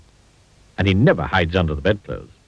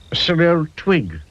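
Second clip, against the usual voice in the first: usual speaking voice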